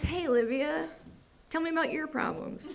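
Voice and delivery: high-pitched therapist check-in; Tara, imagined therapist. Retold or imitated. Therapist opens session from disaster, high imitated therapist voice. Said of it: "and she goes".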